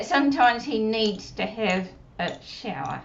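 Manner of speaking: ordinary talk, nothing marked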